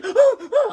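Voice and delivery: high gasping yelps; short high cries of alarm; panicked yelps. Retold or imitated. Boy pulling his leg from icy water, high yelps. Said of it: "I was like".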